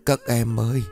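crying while calling out; words broken by sobs; sobbing. A narrator's reading. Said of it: "nói trong tiếng nấc".

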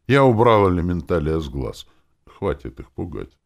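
ordinary delivery, nothing marked

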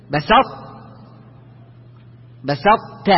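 "بسطت" with heavy ط held, then ت released. Shown as a demonstration. Teacher demonstrates the letter staying elevated, heavy ط into ت. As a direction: hold بسط heavy and unbounced before the ت. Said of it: "مفخم أو مستعلي".

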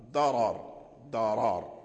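ضرر with a full, heavy r. Shown as a demonstration. Teacher showing Warsh's heavy r in the Quranic word. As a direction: say ضرر with a thick, rounded r. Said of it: "بتفخيم الراء".

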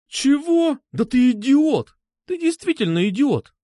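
high, loud incredulous outburst; outraged. A narrator's reading. Girl learns grandpa liked him, outraged. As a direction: exclaim it high, leaning hard on the insult.

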